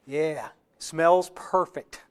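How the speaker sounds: slow savoring. Real, not acted. Savoring newcomer's smell.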